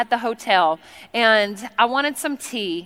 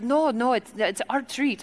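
softly insistent, faked Congolese accent; mock Congolese accent; 6 dB quieter than usual. Tea lady declines the tip, soft mock Congolese accent. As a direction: imitate her gentle refusal in Congolese accent. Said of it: "her accent sounded nothing like this".

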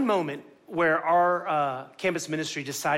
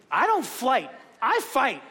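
higher, punchy defiance; mock fighting bravado; 5 semitones higher, slower than usual. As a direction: square up with playful bravado. Retells urge to fight back.

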